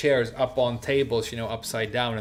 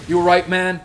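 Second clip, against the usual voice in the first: higher, loud, imitated check-in; rescuer checking in; 6 semitones higher, 9 dB louder than usual. Rescuer checking on the food-covered customer, loud concern. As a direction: call it out as the big rescuer. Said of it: "this guy who, like, saved me".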